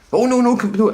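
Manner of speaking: a retold panicky come-back; flustered plea. Salesman begging the walking-out customer, high and flustered.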